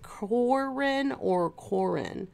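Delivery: two slow tries at "Corin"; comparing pronunciations. Shown as a demonstration. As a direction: weigh up "Corin or Corin", drawn out. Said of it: "am I saying it right?".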